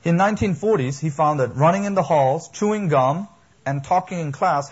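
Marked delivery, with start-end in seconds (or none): 1.5-4.7 listed loudly and higher, each item hit hard; "chewing gum" raised and punched as a loud point; loud emphatic list. Real, not acted. Preacher listing 1940s school problems.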